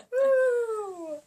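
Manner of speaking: high loud whoop; delighted woo; excited whoop. Real, not acted. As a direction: cheer it out. Cheering over the joke.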